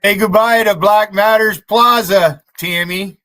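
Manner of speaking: loud, gloating call-out; jeering shout at a rival; taunting shout. Real, not acted. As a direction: yell it in his face with glee.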